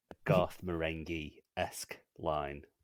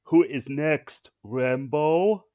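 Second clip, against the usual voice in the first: slow, higher, louder Arnie voice; Arnie quip; 7 semitones higher, 9 dB louder, slower than usual. Retold or imitated. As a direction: deliver it like a one-liner. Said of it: "he quips".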